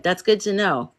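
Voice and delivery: usual speaking voice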